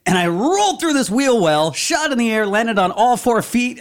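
high, loud breathless-with-news boasting, acted out; excited cat storyteller. Cat telling the guys about its wheel-well escape, excited mock boast. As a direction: brag to your pals about a narrow escape. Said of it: "He said".

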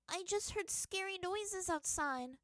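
high, slow, small timid voice; girl weakly answering her father; weak little girl. A narrator's reading. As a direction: sound small and frightened. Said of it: "she said weakly".

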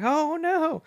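high squeaky mock panic; mock cry for help. Stinky Pete calls out to the bandits' victims, squeaky mock panic. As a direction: cry out like a helpless old man, hammed-up. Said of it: "he's like".

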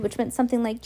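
usual speaking voice

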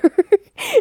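short high laugh; nervous laugh. Real, not acted. Laughing at having cancer herself, a short high laugh. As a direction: laugh it off awkwardly.

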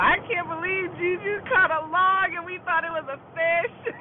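high, loud retold amazement; amused disbelief. Family on the ride back about the log, amused disbelief. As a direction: exclaim it high and loud, on the edge of laughter. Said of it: "he can't believe it".

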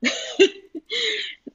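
high, bright burst of laughter. Real, not acted. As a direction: let a giggle burst out high. Laughing at Jimmy Neutron idea.

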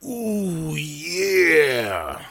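strained wrestler growl; constipated macho man. Acted. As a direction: squeeze out a macho wrestler grunt. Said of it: "the most constipated macho man I've ever heard".

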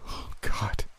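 whispered, horrified realization; hushed, dawning fear; dread. Acted. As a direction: let it out softly on a breath. Narrator realizes friend may be compromised.

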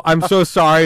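high, very loud retold apology; hammed-up apology at high volume; mock apology. Friend apologizing to the panting kid, hammed-up and loud.

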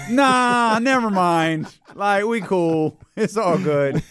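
high, laid-back dismissive voice; casual brush-off. Retold or imitated. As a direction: wave it off with a laugh. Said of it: "it was like".

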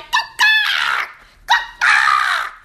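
shrill, high squawking bird call shouted out; mock chicken caw. Giant chicken sandwich jumping on her, a hammed-up squawk. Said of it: "is that the noise that chickens make".

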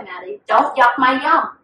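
normal talking voice

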